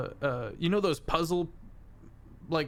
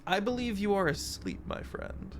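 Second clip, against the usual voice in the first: haughty fey mentor; 7 semitones higher than usual. Acted. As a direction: answer in the fey's high voice, trailing into softness. Fey mentor answers sleeper.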